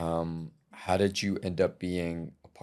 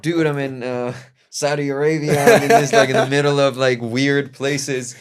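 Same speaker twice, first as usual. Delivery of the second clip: higher and louder, hammed-up busy traveller on the phone; mock phone call voice; 7 semitones higher, 10 dB louder than usual. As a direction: act out your friend shouting down the line. Imitating friend on phone calls.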